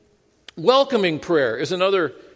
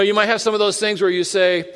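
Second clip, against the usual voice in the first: ordinary talk, nothing marked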